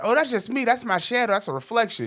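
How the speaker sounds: high mock-innocent quote; brushing it off. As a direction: mimic her high-pitched, dismissive excuse. Acting out her excuse.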